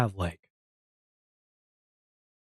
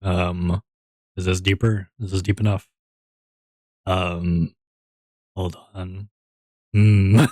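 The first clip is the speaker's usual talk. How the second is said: deeper voice; 6 semitones lower, slower than usual. Shown as a demonstration. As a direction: push the voice down low on request. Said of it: "Go deeper".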